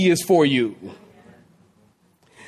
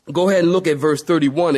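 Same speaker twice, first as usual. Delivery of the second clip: usual speaking voice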